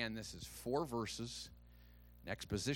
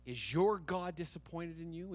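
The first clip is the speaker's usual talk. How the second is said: ordinary delivery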